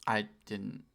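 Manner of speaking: usual speaking voice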